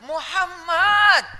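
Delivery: high, long-drawn call of a name; joyful proclamation. Acted. Mother naming her newborn, proclaiming call. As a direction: stretch the name out, pitched high.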